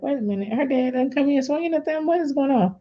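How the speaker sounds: mock-baffled inner thought; retold bewilderment. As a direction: puzzle it out in a high, small voice. Retold bewilderment at friend's house.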